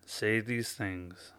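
soft, slowed guided breathing instructions; gentle meditation guide voice. Acted. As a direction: ease them into calm, quietly and slowly. Leads a breathing exercise.

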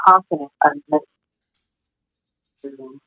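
breaking up on a bad connection; words cutting out over the line; clipped phone line. Real, not acted. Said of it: "you're breaking up a bit".

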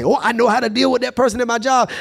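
high-pitched, self-righteous churchgoer; mock self-righteous. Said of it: "get so self-righteous and say".

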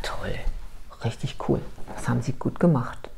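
very quiet, almost whispered congratulation; muted mock praise. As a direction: mutter the praise under your breath, low. Therapist's dry reply to boast. (trockenes Lob, ganz leise gemurmelt)

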